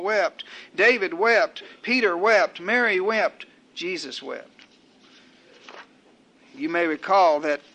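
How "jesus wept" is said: low, much softer close to the list; hushed climax. Real, not acted. Preacher closes a list of who wept, hushed. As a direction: drop low and quiet for the weight of it.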